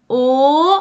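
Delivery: «او» as a long, loud rounded back o; plain O vowel. Shown as a demonstration. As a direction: hold a clear back o on «او». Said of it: "این او بود". («او» کشیده و بلند مثل o انگلیسی)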